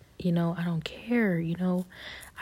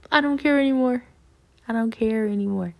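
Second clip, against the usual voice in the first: higher, louder burst, easing on the repeat; defiant outburst; 6 semitones higher, 6 dB louder, faster than usual. Real, not acted. Answering those who tease the crying, defiant outburst. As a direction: declare it high and forceful, then settle slightly.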